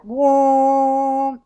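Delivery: loud, long droning "whoa" imitating a didgeridoo; didgeridoo drone. Storyteller showing how the didgeridoo sounds, long loud drone. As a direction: imitate a didgeridoo blast on one held note. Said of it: "like that. Like, it makes that kind of sound".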